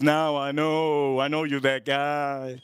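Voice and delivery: put-on slow, knowing old-man voice; old clerk deciding he recognizes the customer, slowly. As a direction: drag it out like he's cracked the case. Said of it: "this old man".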